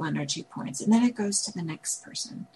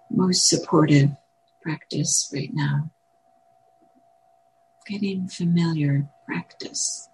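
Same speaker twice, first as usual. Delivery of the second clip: calm, spacious meditation guidance; guided meditation; 8 dB louder than usual. Acted. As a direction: guide gently with long pauses. Leads a meditation.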